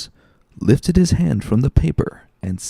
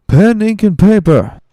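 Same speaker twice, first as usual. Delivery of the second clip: loud, high call across the room; much louder, higher summons to the waiter; calling loudly; 12 semitones higher, 11 dB louder than usual. Acted. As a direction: shout for the waiter to bring them. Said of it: "then called Fernand loudly".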